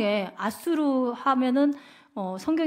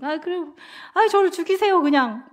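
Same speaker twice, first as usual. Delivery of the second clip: higher, louder "just kill me"; petulant outburst; 5 semitones higher, 4 dB louder than usual. Retold or imitated. Jonah raging at God in the heat, a high tantrum. Jonah angry after the vine dies.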